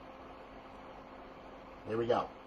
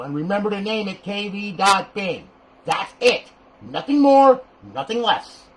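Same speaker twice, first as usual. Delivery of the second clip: emphatic rant; 5 semitones higher, 6 dB louder than usual. Real, not acted. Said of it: "I'm done with that rant".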